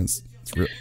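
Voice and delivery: breathy mock-serious whisper. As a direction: hush it like a grim secret. Mock dramatic reply whisper.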